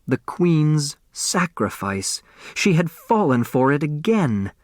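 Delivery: ordinary delivery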